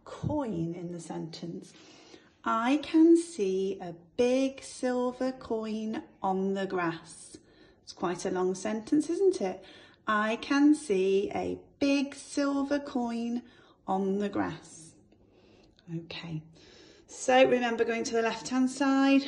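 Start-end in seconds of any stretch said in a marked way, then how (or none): 2.4-7.4 slow, careful reading of the sentence; teacher dictates slowly to the class; slow dictation. Shown as a demonstration. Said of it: "write a sentence now". 10.1-14.9 slow, careful reading of the sentence; teacher dictates slowly to the class; slow dictation. Shown as a demonstration. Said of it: "write a sentence now".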